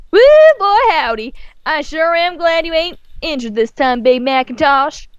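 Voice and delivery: a hint of a perky farm girl; Applejack's voice. A narrator's reading. Reader voicing Applejack to Big Mac. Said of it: "she said".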